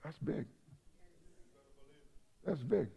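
very soft, with long pauses; quiet wonder, said almost to himself; hushed awe. Real, not acted. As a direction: let it sink in, barely voiced. Letting the awe sink in.

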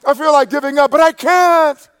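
very high, loud, held-out cry; shouted declaration. Acted. Preacher modelling what to tell God when tempted to quit, shouting. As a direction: belt it out to God with full force. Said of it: "God, say".

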